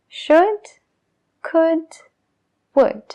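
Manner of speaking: "Should", "could", "would" all rhymed, no L, first one high; silent l. Shown as a demonstration. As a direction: contrast only the first sound of each. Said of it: "lo único que cambia es la primera parte". ("should", "could", "would" sin la L)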